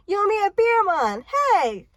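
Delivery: high, loud indignant call; acted shout across a crowd; shouting after someone. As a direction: yell it at a retreating back. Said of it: "he shouted after me".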